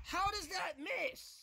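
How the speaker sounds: high, drawn-out angry protest; incredulous whine. Real, not acted. Player protesting a missed shot, incredulous whine. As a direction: stretch it out high in disbelief.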